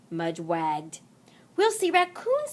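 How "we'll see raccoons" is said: put-on much higher, eager kid voice; excited child. Henry telling Mudge, high and eager. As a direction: pitch it up like a thrilled little boy. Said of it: "said Henry".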